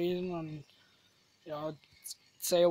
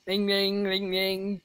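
sung-out phone ring, louder and higher; ringtone imitation; 3 semitones higher, 4 dB louder, slower than usual. Vlogger turning the bank's name into a ringtone, mimicked ringing. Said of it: "I think it should be a ringtone".

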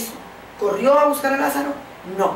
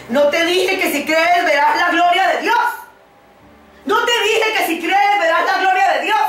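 loud, high, insistent rebuke; raised rebuke; 8 semitones higher, 4 dB louder than usual. Retold or imitated. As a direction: call it out like a firm reproach. Jesus rebukes Martha, voiced by preacher.

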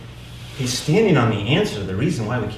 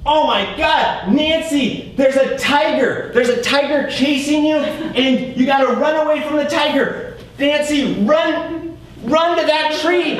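much higher, louder, slow urgent calls to Nancy; urgent warning; 6 dB louder, slower than usual. Acted. Peter warning Nancy of the tiger, slow high urgent shout. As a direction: yell it slowly like a tiger is right there. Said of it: "he says".

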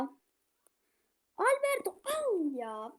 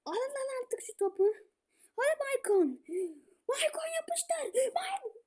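desperate urging; 8 semitones higher, 4 dB quieter than usual. Real, not acted. Gamer desperately urging Maicon to strike, crying pleas. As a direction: wail the name in despair.

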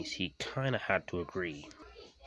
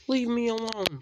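high, loud protest; loud, high-pitched and fed up; annoyed retort; 7 dB louder than usual. A narrator's reading. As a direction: push the teaser away in a raised voice. Engine snaps back at teaser.

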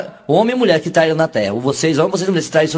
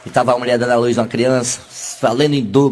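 ordinary talk, nothing marked

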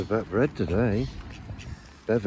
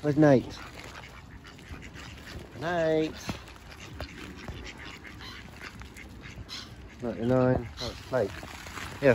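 loud, bright calling-out; calling to birds; 3 semitones higher, 3 dB louder than usual. Real, not acted. Feeding the birds, loud fond calling out. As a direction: call each bird over as you feed.

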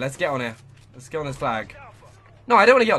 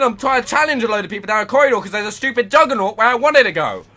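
usual speaking voice

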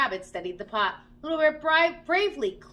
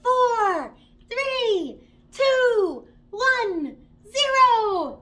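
loud, much higher countdown building to launch; excited countdown; 11 semitones higher, 9 dB louder, much slower than usual. Acted. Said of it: "began a countdown".

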